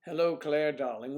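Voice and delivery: bright, loud hello across the mic; loud greeting. Real, not acted. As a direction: call out a cheery hello. Greeting friend after stream drop.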